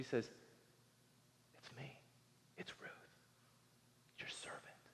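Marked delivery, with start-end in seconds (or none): 1.6-4.8 soft, unvoiced whisper; hushed whisper. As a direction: whisper it humbly in the dark. Ruth answers Boaz at night.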